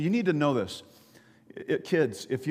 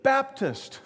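one word, pitched high; calling down; 11 semitones higher than usual. Acted. Jumper naming his denomination to bystander, calling down. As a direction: call out the answer, acted.

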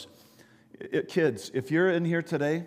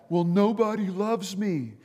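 higher-pitched, acted yell back; mild mock yell; 5 semitones higher than usual. Jumper on a bridge to the only bystander, mock yelling. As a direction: call back from a distance, pitch raised. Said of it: "the guy yelled back".

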